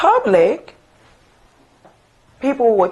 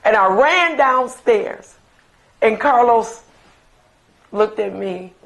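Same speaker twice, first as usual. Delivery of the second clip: ordinary delivery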